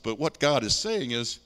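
ordinary delivery

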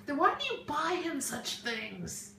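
ordinary talk, nothing marked

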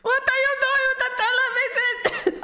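high mock kid voice, laughing; mimicking the kid. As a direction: parrot the kid's line through a laugh. Repeating the kid's line, laughing.

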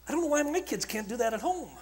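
ordinary talk

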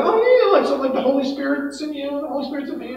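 high, soft, quick mock agreement; naive agreement imitation. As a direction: imitate someone eagerly but mistakenly relating. Voices naive Christians' reaction.

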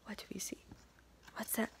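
hushed suspense; whisper. Real, not acted. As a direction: whisper to build the mystery. Hushed suspense over page.

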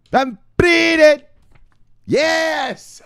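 high, drawn-out shout; hyped shout-out. Pretend. Hailing a viewer who joined the show, hyped shouting. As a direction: stretch the name into a gleeful holler.

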